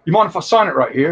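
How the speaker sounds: put-on terrible New Zealand accent, quick; Daniel Logan. Actor offering to sign a helmet, mock New Zealand accent. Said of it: "that was my terrible New Zealand accent".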